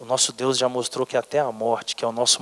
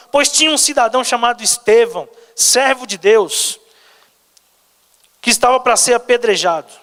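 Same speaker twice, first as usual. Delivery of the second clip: high, raised sermon voice; pitched-up pulpit delivery; impassioned preaching; 8 semitones higher, 7 dB louder than usual. Acted. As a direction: proclaim the story with urgency. Preaches and reads scripture forcefully.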